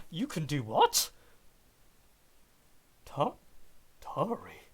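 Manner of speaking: loud, amused disbelief as he listens; playfully astonished at what the fairy tells him; mock surprise. Uldir reacting loudly to the fairy's tale, mock surprise.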